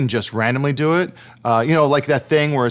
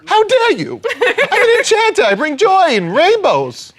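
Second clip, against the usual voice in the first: very high, indignant fey voice; foppish, fey character. Pretend. Enchanter offended at being knocked out, in a high foppish voice. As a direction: protest like an affronted little fop. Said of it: "Very foppish".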